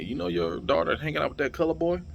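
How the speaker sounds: high-pitched tattling voice, rising at the end; mock busybody question; imitated gossiping townsperson. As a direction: mimic someone reporting to a mother with disapproval. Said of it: "going to her mother, like".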